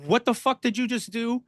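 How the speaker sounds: high-pitched retold disbelief; incredulous. Wrestler to promoter who just punched him, incredulous. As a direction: act out a stunned, indignant question.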